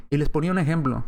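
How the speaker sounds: ordinary talk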